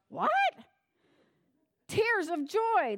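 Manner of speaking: usual speaking voice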